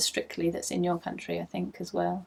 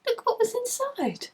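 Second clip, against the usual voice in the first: much higher and louder, squealing with delight; thrilled; 13 semitones higher, 5 dB louder than usual. Real, not acted. Knitter revealing a gifted parcel to viewers, thrilled squeal. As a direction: squeal over the gift. Unwrapping gift yarn.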